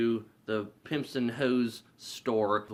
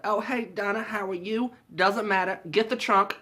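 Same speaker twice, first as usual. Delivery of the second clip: high-pitched mock movie voice; movie character impression; 9 semitones higher than usual. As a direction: act out the brisk lines high. Quotes a film character's lines.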